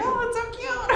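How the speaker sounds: high squeaky gushing; squeaky cute voice. Retold or imitated. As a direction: gush like a fangirl, way up high. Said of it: "I'm like".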